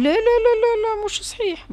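high, squeaky rapid no's; frantic denial. A narrator's reading. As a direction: deny it in a panic. Husband hastily denies. (الزوج ينكر بسرعة وبصوت رفيع مذعور)

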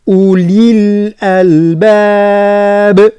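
أولي الألباب recited slowly, linked together; connected recitation. Shown as a demonstration. Teacher models recitation without a pause. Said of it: "إبراز الكسرة".

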